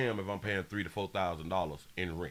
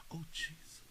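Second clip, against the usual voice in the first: whisper; 8 semitones higher, 12 dB quieter than usual. Real, not acted. Shocked at the rent sum.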